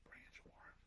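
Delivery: whispered to himself under breath; whispering. Real, not acted. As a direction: say the name in a whisper. Repeating name to himself, unvoiced.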